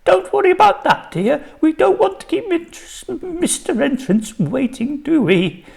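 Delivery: very high, shaky nervous voice; frightened lady, wavering and high. A narrator's reading. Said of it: "she said in a shaky voice".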